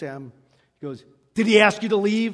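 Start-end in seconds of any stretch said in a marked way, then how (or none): 1.3-2.3 louder, firm question; stern challenge. Retold or imitated. Biker Bob confronting the drunk, loud and stern. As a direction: lean in like a big bouncer.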